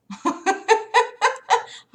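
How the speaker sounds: high-pitched giggling laugh. Real, not acted. Streamer greeting her chat, high giggle. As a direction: let a squeaky laugh burst out.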